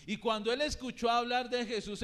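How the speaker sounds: ordinary talk, nothing marked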